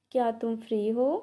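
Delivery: ordinary delivery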